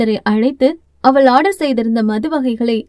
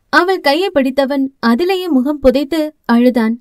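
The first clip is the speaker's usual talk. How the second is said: usual speaking voice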